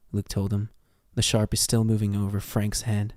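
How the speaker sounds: normal talking voice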